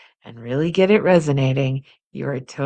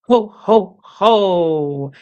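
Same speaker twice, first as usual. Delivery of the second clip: Santa ho ho ho; 3 semitones higher, 5 dB louder, much slower than usual. Shown as a demonstration. Teacher shows students a Santa belly laugh. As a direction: pump each ho from the belly like Santa. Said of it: "Play Santa".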